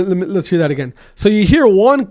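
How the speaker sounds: ordinary delivery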